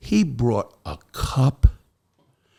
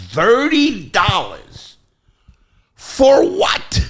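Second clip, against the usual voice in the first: slow, then shooting high and loud; indignant shriek at the price; outraged disbelief; 11 semitones higher, 5 dB louder, much slower than usual. Retold or imitated. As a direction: drag out the price, then explode. Outraged at McDonald's bill.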